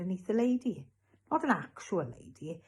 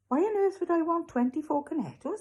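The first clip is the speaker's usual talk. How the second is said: high, louder baffled question; amused incredulity; 12 semitones higher, 5 dB louder than usual. A narrator's reading. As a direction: scoff lightly at an absurd offer. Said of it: "said Ben with a laugh".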